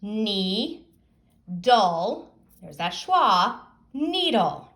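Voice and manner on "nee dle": "Knee, dull" as two separate slow syllables. Shown as a demonstration. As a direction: break needle into "Knee" ... "dull", pausing between. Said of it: "Now I read syllable by syllable".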